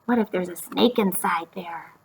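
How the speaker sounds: hushed, scared whisper; a worried little girl whispers about the snake. Acted. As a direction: breathe it like a frightened child in the dark. Scared girl voiced in story.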